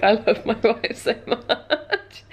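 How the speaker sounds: adoring imitation dissolving into laughter; laughing mock devotion. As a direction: gush it while cracking up. Laughing while quoting the barterer.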